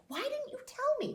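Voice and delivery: very high-pitched retold question; mock incredulity. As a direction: act out a client baffled by the secret. Client's astonished question, retold.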